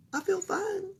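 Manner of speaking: put-on high soft girl voice; small girl insisting; child voice. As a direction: Camilla telling the doctor she's fine. Camilla repeats.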